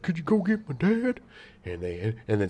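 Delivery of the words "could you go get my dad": put-on high-pitched pleading child voice; imitated kid. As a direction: imitate a kid begging for help. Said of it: "they're like".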